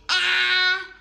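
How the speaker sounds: a loud, open-mouthed ah sound. Retold or imitated. Child told to say ah, big loud ah. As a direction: shout out a big ah. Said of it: "Open the eyes and say".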